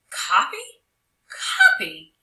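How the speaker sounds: high, loud incredulous echo; shocked disbelief. Acted. Tommy can't believe he must copy, high and outraged. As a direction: repeat it in outrage.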